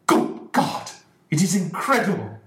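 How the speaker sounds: loud, high shocked outcry. Acted. Ferguson reacting to his son's jealousy, loud high outcry. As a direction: throw the words out in disbelief.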